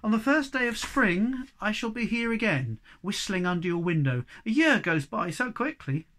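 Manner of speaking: a friendly traveller saying goodbye; Snufkin's farewell. Acted. Snufkin saying goodbye to Moomin Troll, lightly voiced.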